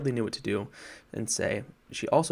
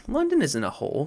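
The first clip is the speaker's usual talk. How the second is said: indignant, higher-pitched protest; 6 semitones higher, 4 dB louder than usual. A narrator's reading. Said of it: "said Polly indignantly".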